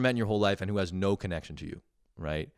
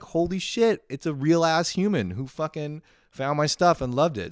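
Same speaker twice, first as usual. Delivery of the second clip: higher and louder, amazed; excited; 10 semitones higher, 7 dB louder than usual. Retold or imitated. Realizing a real fan found his stuff, amazed and high. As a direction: gush with disbelief. Retelling his own thrilled reaction.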